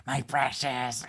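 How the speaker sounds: Gollum impression. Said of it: "I don't do a good Gollum impression".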